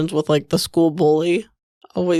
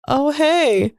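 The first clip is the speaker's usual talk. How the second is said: higher, cheery ad-style greeting; lightly voiced mascot; 7 semitones higher than usual. Voicing a cereal mascot ad.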